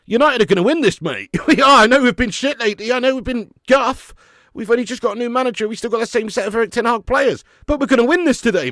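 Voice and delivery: loud, high, defiant fan talk; pumped-up football fan; fired-up insistence. Real, not acted. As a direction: convince the chat you will win. Insists United will win.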